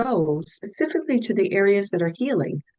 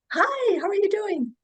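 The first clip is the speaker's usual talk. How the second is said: a much higher, sing-song hello; bright cheerful greeting. Retold or imitated. Recovered patient calling her healer, bright and cheery. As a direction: sound like the patient suddenly well and chirpy.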